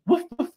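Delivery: high, light woof woof for the puppy; greeting the family puppy with mock barks; playful dog woofs. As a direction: bark like a little dog, playfully. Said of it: "That's for Gemma".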